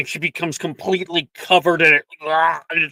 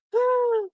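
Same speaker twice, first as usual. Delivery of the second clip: gasp. Acted. Girl frightened by boggart.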